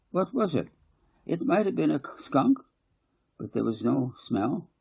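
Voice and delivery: put-on a small girl explaining to her mum; little girl voice. Child voices in dialogue.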